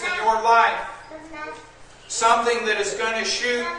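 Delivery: loud urgent preacher warning; preaching. Acted. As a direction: thunder out the warning. Warning sermon at full force.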